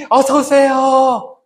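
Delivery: high, slow welcoming greeting at the door; cheerful greeting. Shown as a demonstration. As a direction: greet a newcomer warmly and brightly. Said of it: "하는 거예요". (밝고 높은 환영 인사)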